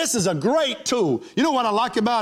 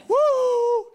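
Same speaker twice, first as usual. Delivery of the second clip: whoop. Real, not acted. Playful cheer in sermon.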